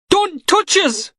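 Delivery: high, slow, panicked cry; a frightened man flinching from hands; panicked plea. Acted. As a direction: recoil and cry out in fear. Knox panicking as pushed.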